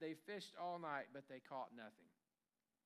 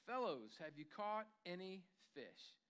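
ordinary talk, nothing marked

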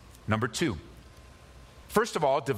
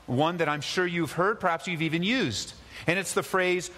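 ordinary talk, nothing marked

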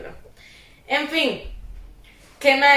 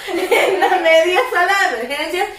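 ordinary talk, nothing marked

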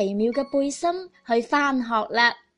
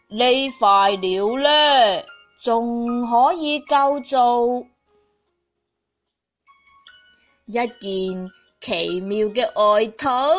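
lower, slow grandpa voice; 3 semitones lower, slower than usual. Acted. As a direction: muse it slowly like a kind grandpa. Grandpa speaks while sewing.

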